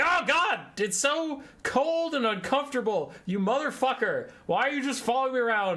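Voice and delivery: high, loud anguished cry; pained yelling. Retold or imitated. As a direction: yell in pain and outrage. Grabbed, cold heart, cursing.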